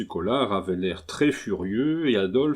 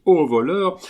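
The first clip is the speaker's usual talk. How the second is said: higher and much louder, a startled scream; cry for help; 7 semitones higher, 7 dB louder than usual. A narrator's reading. Louise wakes and cries out at the intruder, alarmed. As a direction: shout it as if woken by a thief. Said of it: "Louise, réveillée, crie".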